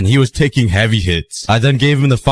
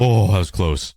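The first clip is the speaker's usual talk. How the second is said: usual speaking voice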